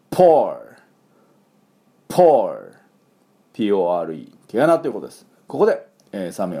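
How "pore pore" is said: "pore" loud and falling, exploded P; repeat-after-me demo. Shown as a demonstration. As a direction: model "pore" forcefully for learners, ending on R. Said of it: "私の後についていきましょ". (「pore」を復唱用に強くはっきりと)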